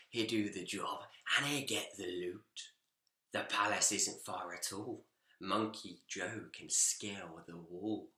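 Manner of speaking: a hint of a sly burglar muttering plans; plotting villain.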